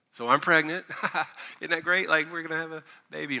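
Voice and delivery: light laugh through the news; laughing mock announcement. [name] announcing pregnancy, laughing.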